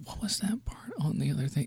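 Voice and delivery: hushed mock whisper. Imitating a chatty moviegoer whispering to a friend. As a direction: lean in and whisper it. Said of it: "leaning over and going".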